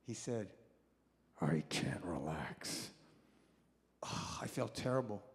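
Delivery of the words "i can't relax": low, breathless whisper; sick uncle whispering from his hospital bed. Retold or imitated. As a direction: rasp it out with no voice. Gasping uncle in oxygen mask.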